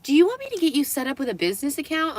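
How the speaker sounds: high, fast mock sales offer; imitated eager employee. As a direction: pitch it like a keen shop assistant.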